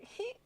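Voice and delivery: tiny, high, soft squeak; imitated little laugh. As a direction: mimic someone's short laugh in a small high voice. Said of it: "You're just like".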